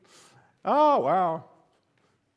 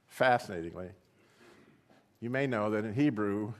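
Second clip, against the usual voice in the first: ordinary talk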